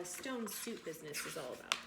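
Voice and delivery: usual speaking voice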